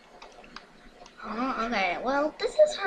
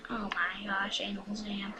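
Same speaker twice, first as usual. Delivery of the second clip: normal talking voice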